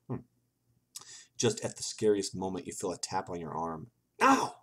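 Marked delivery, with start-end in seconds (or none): 4.2-4.6 short, high, loud "ow!"; acted startled yelp; yelped scream. A narrator's reading. Reading out the player's scare at a tap on the arm, yelped scream. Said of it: "you scream".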